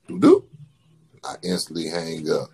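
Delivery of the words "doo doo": very high, loud two-note beep; imitated call beep. Copying the robocall connection sound, a high two-note beep. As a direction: sing the connection tone. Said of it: "and then they go".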